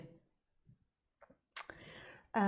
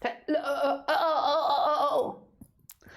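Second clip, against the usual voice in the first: glitchy breaking-up call sound; stuttering like a dropped call; Skype cutting out; 6 semitones higher, slower than usual. Shown as a demonstration. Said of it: "That's my impression of Skype cutting out".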